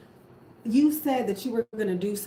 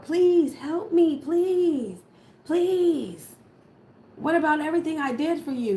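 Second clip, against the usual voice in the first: high, loud, hammed-up begging; mock desperate plea of someone in hell; pleading wail; 7 semitones higher, 4 dB louder, slower than usual. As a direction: beg shrilly for rescue. Voicing the desperate toxic person.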